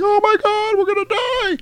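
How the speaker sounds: very high, hammed-up terrified wail; mock panic. Falling elevator passengers crying out, exaggerated high panic. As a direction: squeal it in fake terror. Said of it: "And everybody's like".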